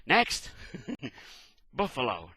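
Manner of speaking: a low, slowed name broken by a laugh; a chuckle breaks the role; laughing through it. Acted. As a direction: crack up while calling.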